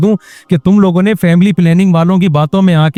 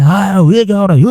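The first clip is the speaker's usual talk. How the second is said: a hint of a furious father barking at home; higher, half-playful imitation of a shouting dad; mock Genghis Khan yell; 4 semitones higher than usual. Father storms home and shouts at the family, mock angry yell. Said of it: "چنگیز خان بن کے چیخ رہا ہے".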